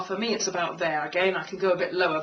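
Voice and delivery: normal talking voice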